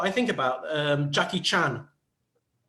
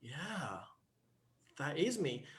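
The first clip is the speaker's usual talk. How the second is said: soft, spaced-out dawning acceptance; hushed moment of self-recognition; quiet realization; 3 semitones lower, 12 dB quieter, slower than usual. Acted. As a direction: let it sink in, very softly. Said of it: "then suddenly you realize".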